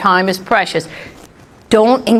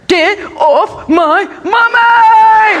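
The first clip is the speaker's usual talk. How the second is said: put-on high, loud, slow child's wail; a small kid yelling to protect mom; hammed-up child shouting; little brother yelling; 10 semitones higher, 3 dB louder, much slower than usual. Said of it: "I heard my brother go".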